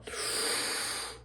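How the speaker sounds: long, quiet exhale; long sigh. Real, not acted. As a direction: let out a long breath. Long breath out before starting.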